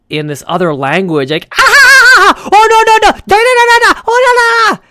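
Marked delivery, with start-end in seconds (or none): 1.6-4.8 high, loud shrieking gibberish; mock screaming. Mocking a screaming Burmese game show, shrill high yells. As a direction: shriek nonsense like a frantic game show host. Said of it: "these people are screaming".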